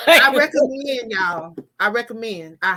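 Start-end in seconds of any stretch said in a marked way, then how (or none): 0.1-1.1 louder, slow, laughing insistence; emphatic recommendation. Real, not acted. Author answering a teasing remark about sounding disappointed, laughing and emphatic. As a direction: push the endorsement loudly through a laugh. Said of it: "You sound so disappointed".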